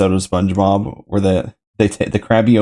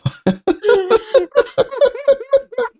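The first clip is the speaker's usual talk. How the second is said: real high-pitched shriek of laughter; helpless high laugh; high squealing laugh. Real, not acted. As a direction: lose it in a squeal. Friend cracks up at story.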